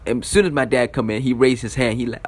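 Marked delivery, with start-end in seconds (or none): none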